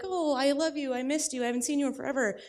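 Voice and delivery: lightly voiced sweet nephew; sweet kid. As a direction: gush like an adoring little boy. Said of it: "so he'll come up and be like".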